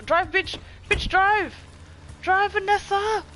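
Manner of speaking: high, loud urging; urgent yell. Real, not acted. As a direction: yell at the driver to go. Urging driver in game.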